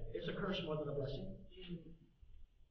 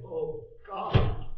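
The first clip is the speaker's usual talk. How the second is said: cry out to God; 9 dB louder than usual. Retold or imitated. Crying out to God in prayer.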